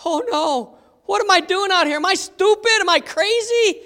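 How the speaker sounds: high, rising panic; panicked Peter. Retold or imitated. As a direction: doubt himself in alarm. Peter panicking while sinking.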